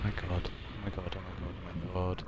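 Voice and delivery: soft, repeated murmur after a fright; shaken muttering. Real, not acted. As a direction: murmur it quietly, still reeling. Recovering from jump scare.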